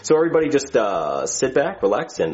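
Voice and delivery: droning pilot intercom voice with long low uhs; captain announcement. Acted. Captain announces flight details to passengers, intercom drone. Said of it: "I really wish they would let us talk normal into these things".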